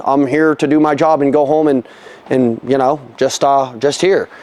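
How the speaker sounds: low, lifeless clock-puncher; monotone. Acted. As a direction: drone it like you just want to go home. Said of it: "do you just sound, like, monotone".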